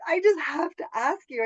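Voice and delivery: high, loud, excited re-enactment; retold eager approach. Re-enacting a knock on her car window, eager excitement. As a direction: burst out with it, re-enacting your eagerness.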